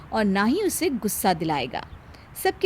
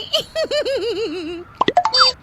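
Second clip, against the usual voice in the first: mocking laugh; 12 semitones higher, 3 dB louder than usual. Bahu laughs after mockery.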